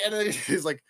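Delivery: normal talking voice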